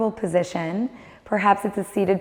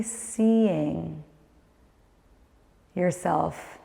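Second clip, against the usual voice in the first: guided meditation; 6 dB quieter than usual. Acted. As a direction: guide the listener inward, calm and unhurried.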